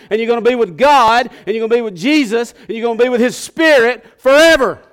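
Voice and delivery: loud, high, climbing preacher's call to the congregation; full-force preaching. Acted. Pastor stirring the congregation awake, preaching at full force. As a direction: preach it out, rising with every line.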